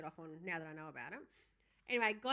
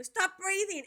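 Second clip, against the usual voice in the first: retold yell; 10 semitones higher, 7 dB louder, slower than usual. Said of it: "All the time to go".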